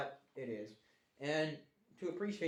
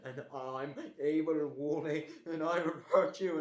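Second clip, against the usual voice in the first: mock mad; 6 dB louder than usual. Shown as a demonstration. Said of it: "hear the difference in my voice".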